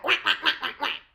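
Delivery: rapid run of imitated quacks; duck quacking. A narrator's reading. Duck quacks from the pond at the cat, noisy quacking. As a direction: quack noisily like an alarmed duck. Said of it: "quacking loudly at the duck".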